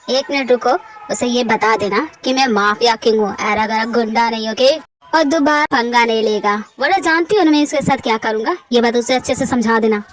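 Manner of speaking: a mafia king warning his sister; menacing mafia boss. Acted. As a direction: lean in like a gangster.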